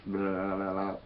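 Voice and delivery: tongue-wagging "blah-blah" gibberish noise; mock mad babbling. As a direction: babble in one unbroken run, playing mad. Mock madness after "as to mad.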